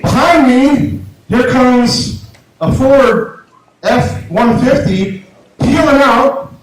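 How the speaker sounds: normal talking voice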